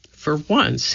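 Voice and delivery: plain reading voice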